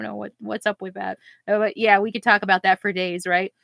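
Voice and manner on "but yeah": ordinary talk, nothing marked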